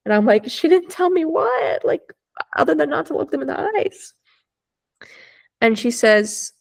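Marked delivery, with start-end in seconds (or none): 0.5-4.1 high-pitched, strained incredulous question; acted-out high bewilderment; alarmed disbelief. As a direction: retell a panicky demand, pitched way up. Acting out alarmed question to stranger.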